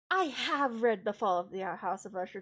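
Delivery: "I have read" hit loud, "have" stressed. Real, not acted. Streamer correcting herself to chat, stressing "have". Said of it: "to be clear".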